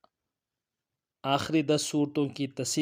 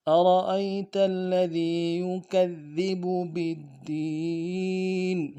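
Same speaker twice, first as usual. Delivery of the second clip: slow melodic tajweed chant; Quran recitation; 4 semitones higher, 4 dB louder, much slower than usual. Shown as a demonstration. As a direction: recite the verse with care. Full recitation of verse.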